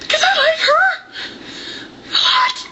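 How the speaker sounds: very high, laughing; laughing high confession. Real, not acted. Confessing love for the character, laughing squeal.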